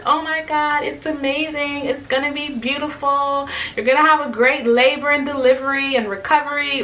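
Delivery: high, softened cooing reassurance; mimicked gushing coworkers. Coworkers circling woman in labor, mimicked gushing. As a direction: imitate over-sweet well-wishers. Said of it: "they're doing all the things".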